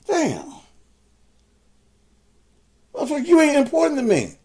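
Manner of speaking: high, fast furious yelling; real rage pouring out, voice cracking high and loud; furious shout. Real, not acted. Shouting at a commenter on his page, furious high yell.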